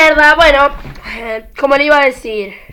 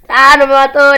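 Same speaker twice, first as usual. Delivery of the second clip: ordinary delivery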